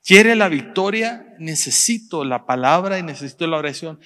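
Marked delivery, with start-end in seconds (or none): 0.0-1.3 preaching challenge. Acted.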